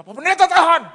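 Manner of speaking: high, very loud retold command; desperate shout. Driver to pastor holding the bike, desperate high shout. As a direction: yell urgently for help stopping.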